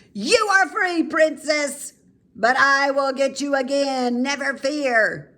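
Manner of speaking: high, loud cackling witch screech. Acted. Witch releasing the princess but threatening her, screeching. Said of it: "screeched out".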